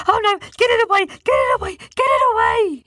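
high shouted panic; phobic panic. A narrator's reading. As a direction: shriek it away in terror. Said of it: "jumped in fear with such fright".

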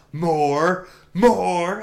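imitated petulant shout, drawn out and rising; Kylo Ren. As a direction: bellow it like a tantrum, half in jest. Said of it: "Kylo Ren just screaming".

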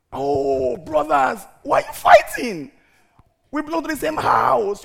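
high, loud pleading with the brothers; pleading Moses. Retold or imitated. Moses pleading with two fighting Israelites, high and loud. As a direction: beg them to stop like a peacemaker. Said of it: "he instantly".

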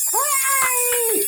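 small high-pitched scream. A narrator's reading. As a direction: squeal it very high at a held-back volume. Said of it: "turned around and screamed".

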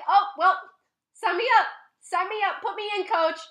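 loud, high, gung-ho volunteer; volunteer yourself with over-the-top enthusiasm; mock eagerness. Mocks own eagerness to stay.

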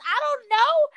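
high-pitched mock-helpless denial; squeaky retold protest; baffled denial. Retells denying blame for talking computer.

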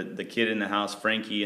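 normal talking voice

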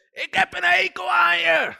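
high, slow, loud shriek in a strange voice; a demon's shrill scream of hatred. Retold or imitated. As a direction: screech it out like a hateful demon. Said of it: "demonen die schreeuwen".

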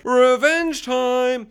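high, loud, stretched battle cry; snapping into vengeance. Retold or imitated. Dean snaps after her death, imitated as a high, loud cry. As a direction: draw it out in a hammed-up high yell. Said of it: "is when Dean snaps".

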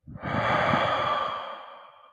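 long, loud breathy sigh out; loud exhale. Shown as a demonstration. Meditation guide demonstrating the out-breath, long loud sigh. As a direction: push the breath out audibly. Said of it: "Deep breath in".

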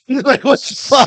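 loud, high burst of laughing disbelief; indignant outburst. Real, not acted. Friend tells how police treat his uncle, laughing indignant shout. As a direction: blurt it out, half laughing, outraged. Outraged at cops questioning uncle.

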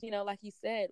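usual speaking voice

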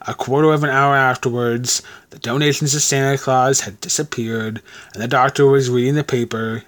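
plain reading voice

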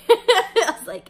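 laughing out loud, slowed. Real, not acted. Telling listeners about refusing, laughing. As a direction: let a laugh take over.

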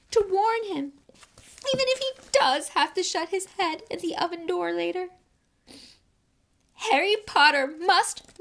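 high, urgent elf warning; high house-elf voice. A narrator's reading. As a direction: plead to save him.

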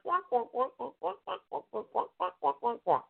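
mock muted trumpet babble; cartoon adult wah-wah sound; wonk wonk noise. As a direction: nonsense grownup droning. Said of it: "now you sound like".

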